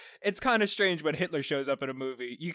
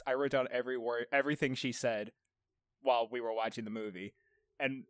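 ordinary talk

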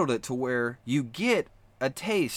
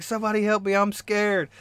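high-pitched imitated plea; a milder, high mock cry for help; mock terrified; 9 semitones higher than usual. Imitating the terrified contestant calling for rescue, mock frightened. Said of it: "he was terrified".

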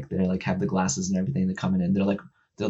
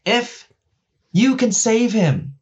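excited; 12 semitones higher, 3 dB louder, much slower than usual. Real, not acted. Excited second secret reveal.

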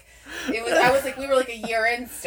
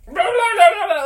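high, loud nonsense wail; losing her mind; 9 semitones higher, 7 dB louder than usual. Retold or imitated. Girlfriend who lost her mind, imitated hysterical babble. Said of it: "like, I lost my mind".

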